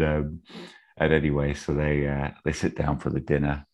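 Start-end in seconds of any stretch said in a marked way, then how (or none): none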